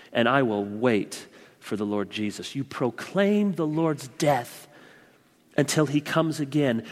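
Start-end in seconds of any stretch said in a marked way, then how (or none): none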